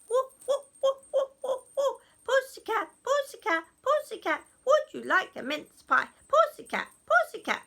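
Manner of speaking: high, loud goblin clamour; shrieky excited goblin chant. Acted. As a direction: pester the cat all at once, high and loud. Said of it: "they got really excited".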